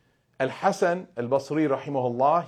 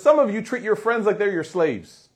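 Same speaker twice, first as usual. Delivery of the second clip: emphatic quote; 8 semitones higher, 7 dB louder than usual. Acted. Quoting the scholar's stark warning.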